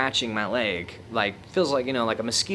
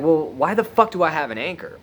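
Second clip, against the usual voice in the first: higher, louder baffled demand; exasperated disbelief; 6 semitones higher, 3 dB louder than usual. Retold or imitated. Asking his friend why he has an anchor, exasperated and louder. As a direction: demand an answer, fed up and baffled.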